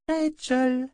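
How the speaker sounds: high, tiny calling voice; small doll voice. A narrator's reading. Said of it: "appela une petite voix". (petite voix de poupée qui appelle)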